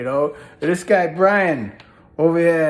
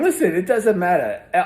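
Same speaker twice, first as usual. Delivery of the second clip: ordinary delivery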